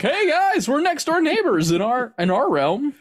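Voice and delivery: a squeaky neighbour popping in to say hi; Moon Knight. Retold or imitated.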